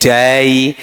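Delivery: very slow, drawn-out poetry recital; imitating the madam's slow recital; madam reciting. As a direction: recite like the literature teacher. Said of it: "ऐसे ही हमारी madam पढ़ रही थी".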